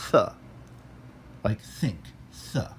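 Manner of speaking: soft "th" with tongue at teeth; voiceless th. Shown as a demonstration. Teacher demonstrating theta as voiceless th. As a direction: hiss out a quiet "th" like think. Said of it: "voiceless dental fricative".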